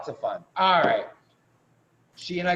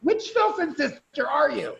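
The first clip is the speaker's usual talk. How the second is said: high, loud puzzled squeak; confused stranger; 7 dB louder than usual. Retold or imitated. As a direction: play someone baffled by identical twins. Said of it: "you're like".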